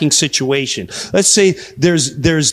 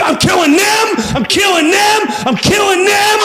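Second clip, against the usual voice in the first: high, frantic repeated yell; hammed-up killing rampage; enraged shouting. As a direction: yell it over and over, each last word rising high. Acted rampage continues.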